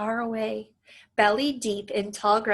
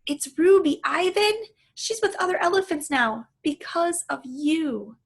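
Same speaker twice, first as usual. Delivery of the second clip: put-on high, loud eager girl; 7 semitones higher, 4 dB louder than usual. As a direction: share good news eagerly. Julia speaking.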